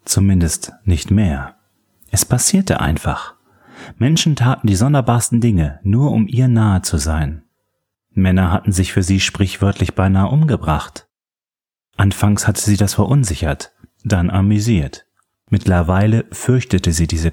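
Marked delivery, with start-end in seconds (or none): none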